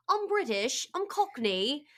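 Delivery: high-pitched put-on Cockney British; mock British accent. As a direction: play at being British and Cockney. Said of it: "probably like us being like".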